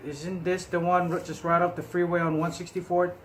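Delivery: usual speaking voice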